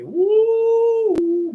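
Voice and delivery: high, loud whoop of delight; remembering those sweet tater cookies, a joyful whoop. Real, not acted. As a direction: let out a joyful woo.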